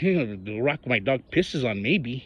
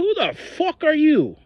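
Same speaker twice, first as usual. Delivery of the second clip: high pitched retort; retold angry retort; 14 semitones higher, 3 dB louder than usual. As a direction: fire it back, pitch shooting up. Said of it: "And I go".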